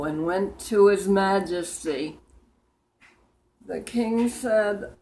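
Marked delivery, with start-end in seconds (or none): none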